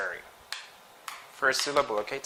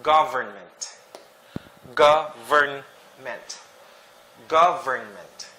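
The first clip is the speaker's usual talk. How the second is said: slow demo; slower than usual. Shown as a demonstration. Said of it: "listen to me carefully".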